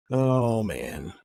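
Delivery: ordinary talk, nothing marked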